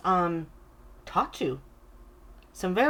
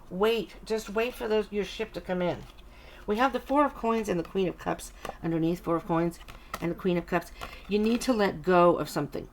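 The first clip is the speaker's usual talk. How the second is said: normal talking voice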